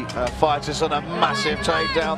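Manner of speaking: loud, excited fight commentary; live play-by-play. Real, not acted. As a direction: call the action as it happens.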